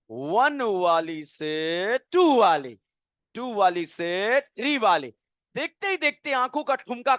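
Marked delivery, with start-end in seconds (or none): 0.1-5.1 slow, drawn-out rhythmic counting; slow teaching chant of one to two to three; sing-song counting. Shown as a demonstration. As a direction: stretch each step out slowly like a chant. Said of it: "अब जो है हम लगाएंगे ठुमका".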